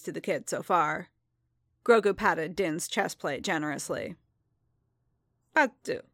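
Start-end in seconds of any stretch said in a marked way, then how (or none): 5.5-6.0 high little creature coo; baby creature word. A narrator's reading. Grogu answering Din with a high creature coo. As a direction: chirp it like a tiny alien child.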